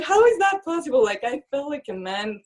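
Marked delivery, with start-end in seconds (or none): 0.0-1.0 pitched way up in retold amazement; high, amazed question; stunned disbelief. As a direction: gasp it out, unable to believe it.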